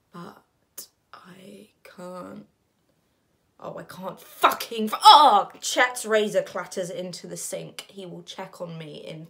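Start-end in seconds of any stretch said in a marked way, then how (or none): 0.1-2.5 slow, breathy near-whisper; strained whisper. Acted. Narrator failing to find the face pack, strained whisper. Groggy, searching the cabinet, gives up. 4.2-5.5 swearing that bursts into a loud cry; frustrated outburst. Acted. Narrator fumbling in the bathroom cabinet, loud frustrated outburst.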